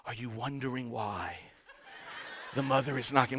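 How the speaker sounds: very soft, low narrator impression; documentary announcer. Announcer explaining the giraffe mother's kicks, hushed documentary voice. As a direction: imitate a hushed nature-film voice-over. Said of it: "the announcer's going like".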